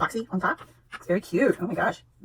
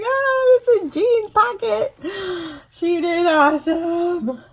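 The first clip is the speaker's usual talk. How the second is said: delighted squeal; 8 semitones higher, 5 dB louder, slower than usual. Real, not acted. Delighted by gift.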